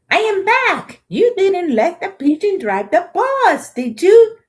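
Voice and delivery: very high, loud accusing bird voice; pigeon accusing. Acted. Pigeon accusing the children of refusing. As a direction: accuse them sharply.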